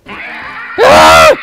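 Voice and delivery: sudden high scream of fright; a real startled shriek. Real, not acted. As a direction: yelp at the jump scare. Jump scare in game.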